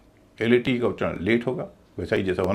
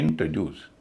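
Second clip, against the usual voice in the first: Introduce as IN-truh-DYOOS; first and third stress; 6 semitones lower, 3 dB quieter than usual. Shown as a demonstration. Teacher demonstrating the rule, introduce with outer syllables stressed. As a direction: stress both ends of introduce. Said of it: "तीसरे हिस्से पर stress है".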